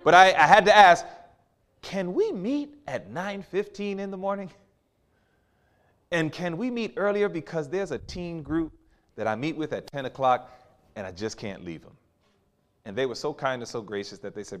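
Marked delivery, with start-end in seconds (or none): 1.8-12.0 gentle request. Retold or imitated. Asking candidates a favor gently.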